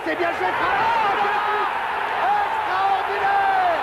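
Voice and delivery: slow stretched shout of extraordinaire; excited sports commentary. Real, not acted. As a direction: call the strike with rising excitement. Live goal call, commentator.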